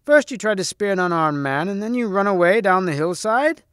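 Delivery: high-pitched mocking question; jeering. Percival mocks the knight's failed charge, jeering. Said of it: "he jeered".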